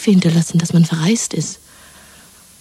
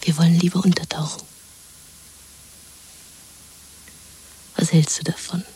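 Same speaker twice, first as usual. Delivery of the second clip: conspiratorial whisper; 3 dB quieter than usual. Acted. Woman plotting escape with her lover: whisper. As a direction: plot it under your breath.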